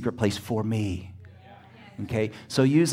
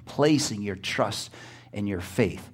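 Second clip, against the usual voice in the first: usual speaking voice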